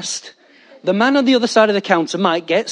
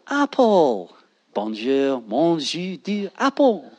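soft, slow, lower fake French; mock French accent, soft and slow; pidgin French; 3 semitones lower, 5 dB quieter, slower than usual. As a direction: mumble clumsy phrasebook French. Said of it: "learn French in a day".

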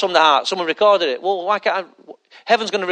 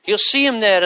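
ordinary talk

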